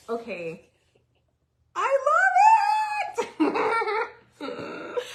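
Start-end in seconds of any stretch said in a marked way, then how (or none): 1.8-5.1 drawn-out, much higher and louder cry of joy, half laughing; excited yell. Real, not acted. Crafter thrilled by her project, calling to viewers, ecstatic yell. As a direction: burst out yelling with delight. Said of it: "I don't know why I'm yelling".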